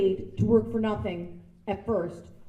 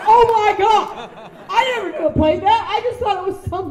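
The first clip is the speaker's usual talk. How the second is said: stunned exclamation; 10 semitones higher, 10 dB louder than usual. Retold or imitated. Woman discovering what her music box plays, loud amazed exclamation. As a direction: cry out in amazed disbelief.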